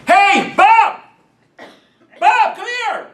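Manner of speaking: hollering to a friend down the block, imitated; mock yell. Someone on the street calling to a friend, mock yell. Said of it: "starts yelling at their friend".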